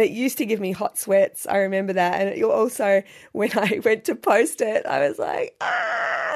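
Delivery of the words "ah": high-pitched mock scream of dread; retold panicked squeal. Recalling dread at posting a video, mock panicked squeal.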